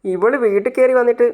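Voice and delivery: ordinary delivery, nothing marked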